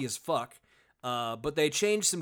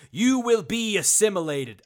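loud, higher mock cyborg threat; Borg; 4 semitones higher, 8 dB louder than usual. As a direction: proclaim it as a hammed-up cyborg collective. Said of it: "what voice the Borg speak with".

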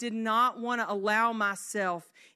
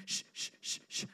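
short repeated soft shushes; quick, breath-only shushing; soothing baby shush; 11 dB quieter, faster than usual. Retold or imitated. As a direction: hush a crying baby rhythmically. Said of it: "was to go".

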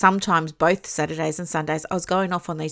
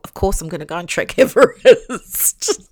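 laughing; 6 dB louder than usual. Real, not acted. Laughing at own naive decision.

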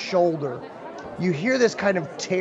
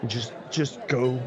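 low warning; 6 semitones lower, 6 dB quieter than usual. Acted. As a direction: push them off in a low murmur. Drunk man keeps warning guests.

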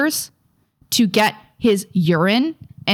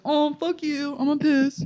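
lightly voiced dog, higher and softer; mock the dog's rude back-talk, a touch pitched up; grumpy dog voice; 5 semitones higher, 4 dB quieter than usual. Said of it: "and he just goes".

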